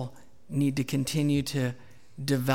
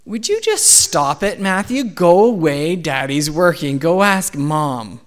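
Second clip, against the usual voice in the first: put-on loud, impatient outburst at a pestering child; exasperated father's shout; 9 dB louder, slower than usual. Dad shooing his interrupting son while working, exasperated shout. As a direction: re-enact losing patience, much louder.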